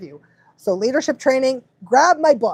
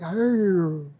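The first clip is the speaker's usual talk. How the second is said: long, falling husky howl; correcting in husky; 4 semitones lower, 4 dB quieter than usual. Shown as a demonstration. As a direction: howl back a serious correction. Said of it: "if I'm correcting that".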